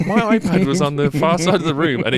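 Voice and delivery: real high-pitched exasperation; worked-up yelling. Real, not acted. Podcaster explaining the iPad's interruption, worked-up and high. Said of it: "I'm yelling about Hans Gruber".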